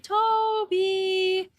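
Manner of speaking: high, sing-song call of a dog's name; kid calling dog. Retold or imitated. The commercial kid calling his dog, high sing-song call.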